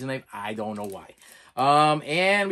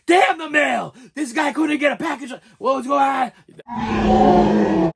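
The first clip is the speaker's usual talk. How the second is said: imitating the angry mailman with a high, loud shout; 9 semitones higher, 8 dB louder, slower than usual. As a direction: shout it as the furious mailman beating the box. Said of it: "This is like".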